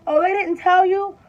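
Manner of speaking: high, loud, breezy doctor's question; mock offhand doctor, high-pitched; nonchalant doctor. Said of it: "so nonchalant".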